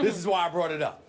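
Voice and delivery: amused, laughing through the words. Real, not acted. Commentator explaining why he brought it up, laughing. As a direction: speak quickly through a chuckle. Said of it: "forgive me for laughing".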